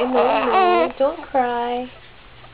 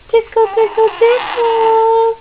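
much higher and louder tickle chant; playful tickle squeal; 10 semitones higher, 8 dB louder than usual. Real, not acted. Tickling the baby.

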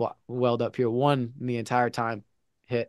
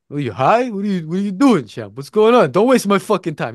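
loud, high-pitched telling-off as the coach; angry coach; 6 semitones higher, 7 dB louder than usual. Retold or imitated. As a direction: imitate a coach chewing out his fighter. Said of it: "He's angry".

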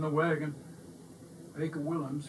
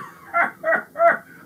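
high, loud delighted laughter; joyful laugh; 10 dB louder than usual. A narrator's reading. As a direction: burst out laughing. Baker laughs with joy.

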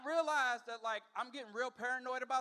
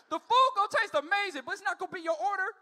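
much higher and louder, shouting the punchline; excited shout; 7 semitones higher, 7 dB louder than usual. Real, not acted. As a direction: belt it out like you can't believe it.

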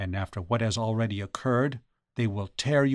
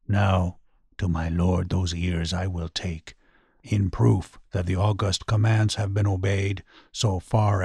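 low, gruff ghostly voice; gruff muttering ghost; 4 semitones lower than usual. A narrator's reading. Ghost muttering close to the blind man about his ears, low gruff voice. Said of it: "the gruff voice muttered close to him".

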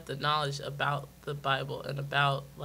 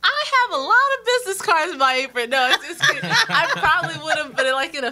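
joking laugh; 11 semitones higher, 10 dB louder than usual. Real, not acted.

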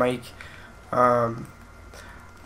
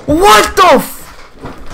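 very high, drawn-out cry of disbelief; stunned outburst; 6 dB louder, much slower than usual. Real, not acted. Gamer reacting to a death on screen, high drawn-out cry. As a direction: let it stretch out in shock. Rage at the game.

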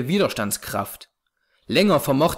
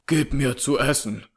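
hoarse call. A narrator's reading. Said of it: "rief jener mit heiserer Stimme".

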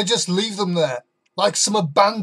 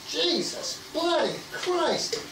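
appalled; 7 semitones higher, 6 dB quieter, slower than usual. Acted. Disgusted exclamation.

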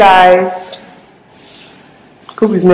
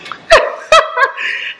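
high breathy laugh; 4 dB quieter than usual. Real, not acted. Laughing at her own joke.

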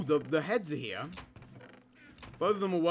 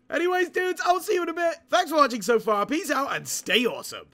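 higher, upbeat host farewell; youtuber sign-off; 7 semitones higher than usual. Acted. Youtuber signing off to his viewers, upbeat. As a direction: say goodbye to viewers cheerfully. Video outro.

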